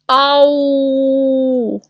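long, high acted "ow" wail; drawn-out high ouch; cry of pain. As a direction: howl as the splinter comes out. Last splinter is pulled out.

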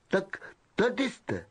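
Malfoy greeting his cronies with a drawl. Acted. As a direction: drawl it out lazily. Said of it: "protáhl".

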